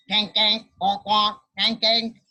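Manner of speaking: loud nasal-free honking quack; small duck quacks. A narrator's reading. Imitating the small ducks begging for food, loud quacks.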